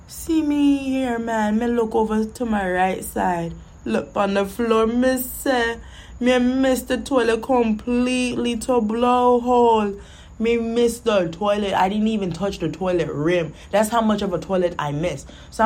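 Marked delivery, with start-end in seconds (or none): 0.1-11.7 high, slow put-on Jamaican patois; mock Jamaican patois. Retelling her bathroom mishap in mock Jamaican patois. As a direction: ham up a Jamaican patois narration.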